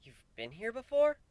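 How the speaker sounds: high, startled question; suddenly baffled; bewildered. A narrator's reading.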